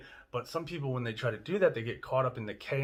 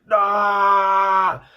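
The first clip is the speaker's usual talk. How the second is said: loud high drawn-out "aah" scream; frustrated scream; 9 semitones higher, 15 dB louder than usual. Acted. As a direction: let the overwhelm burst out in one long yell. All that chaos, acts a scream.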